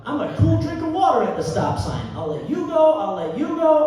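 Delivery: high-pitched, shouted bragging; loud comic boast. Acted. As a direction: belt out the boast far above normal pitch. Comic boast acted out at top voice.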